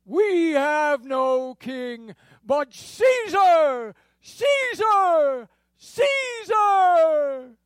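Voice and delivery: high, slow drawn-out outcry; shouted cry. Acted. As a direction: cry out Caesar's name to the heavens. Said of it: "the chief priest shouted".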